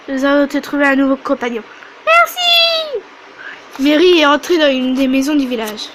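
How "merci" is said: put-on very high little-girl thank you; squeaky child thanks. Mary thanking them before going home.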